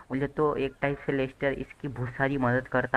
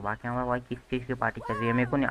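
usual speaking voice

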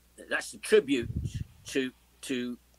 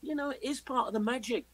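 ordinary delivery

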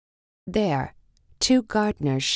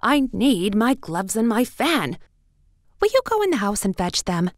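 higher, quick, fussy rabbit orders; 4 semitones higher, 4 dB louder than usual. A narrator's reading. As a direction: rattle off the errand in a rush.

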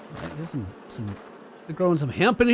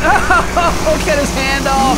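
louder, higher giddy cry; giddy raised voice; excited shout; 7 semitones higher, 6 dB louder, much slower than usual. Real, not acted. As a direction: shout it mid-chaos. Excited chainsaw chaos.

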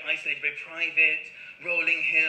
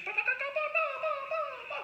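high, soft twittering "ba ba ba"; tiny bird twitter, high and light; birdsong imitation; 6 dB quieter, faster than usual. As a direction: chirp it like a little woodland bird. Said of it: "making lovely noises".

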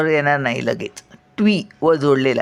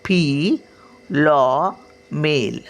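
फिलॉमेल said very slowly and fully; फिलॉमेल stretched, clear and slow; slow model word; much slower than usual. Shown as a demonstration. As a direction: draw out फिलॉमेल so the class can copy it. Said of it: "बघा".

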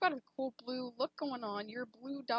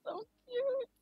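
squealing over cute art; 10 semitones higher than usual. Real, not acted.

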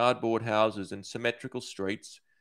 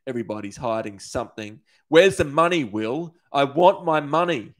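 louder, demanding through gritted teeth; 4 semitones higher, 6 dB louder than usual. A narrator's reading. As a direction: snarl for the money, teeth clenched. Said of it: "through gritted teeth".

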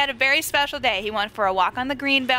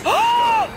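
very high, loud shriek; startled scream; 6 dB louder than usual. Real, not acted. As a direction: scream out in sudden fright. Startled by monster.

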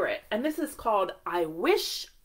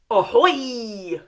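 mock pirate call; 7 dB louder than usual. Prompting kids to say ahoy.

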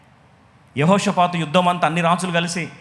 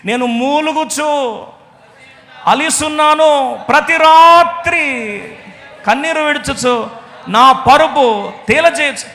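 slow, high, shouted lament; anguished lament; 8 semitones higher, 7 dB louder, much slower than usual. Acted. David weeping at night, slow shouted lament. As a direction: wail the psalm slowly. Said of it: "గుండె పగిలి ఏడుస్తా".

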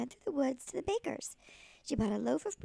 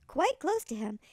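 ordinary delivery

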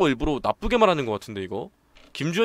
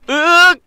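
loud raging groan; 14 dB louder, much slower than usual. Acted. Character groans in rage.

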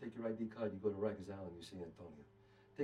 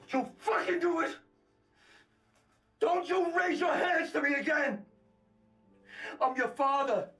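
angry warning; 6 semitones higher than usual. Acted. Father warning son after shove.

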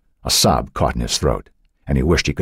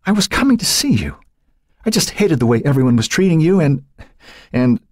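high, tearful, halting explanation; relieved, tearful girl faltering; choking with sobs; 11 semitones higher than usual. A narrator's reading. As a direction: break off as sobs take over. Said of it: "her voice choking as relieved sobs overtook her".

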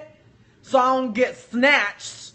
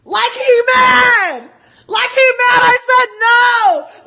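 mocking shout; 12 semitones higher, 9 dB louder than usual. Mocking the man's anger.

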